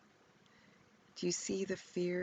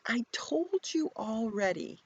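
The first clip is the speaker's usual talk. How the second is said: louder, higher, fed-up retort; exasperated; 7 semitones higher, 6 dB louder than usual. Acted. Healed man to questioning Pharisees, exasperated. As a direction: sigh it out like you've said it before. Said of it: "Is he exasperated?".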